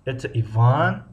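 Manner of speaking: Иван stretched out with the pitch climbing at its end; slow, rising finish on Иван. Shown as a demonstration. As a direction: draw out Иван and lift it like a question. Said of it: "охири ҷумларо мо зиёдтар талаффуз".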